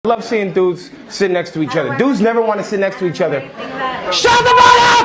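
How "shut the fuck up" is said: furious shout at a heckler. Real, not acted. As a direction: scream it at the heckler. Heckler confrontation onstage.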